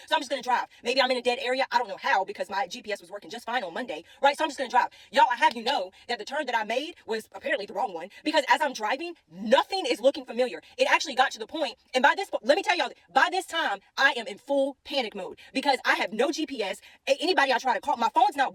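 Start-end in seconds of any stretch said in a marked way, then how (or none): none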